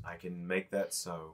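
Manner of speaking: slow, soft menacing warrior; quiet threat. Acted. Threat to guards.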